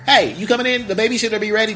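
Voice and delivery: high, loud shout across the street; loud embarrassing mom call. Retold or imitated. Mom loudly calling her son home in front of the girl. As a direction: call your son in for everyone to hear. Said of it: "Just loud".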